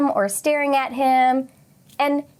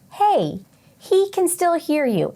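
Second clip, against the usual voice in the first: slow, firm, higher call to strangers; 6 semitones higher, slower than usual. Retold or imitated. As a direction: tell the staring people plainly to stop. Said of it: "she told everybody".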